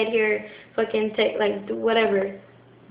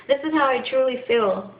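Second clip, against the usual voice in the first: ordinary talk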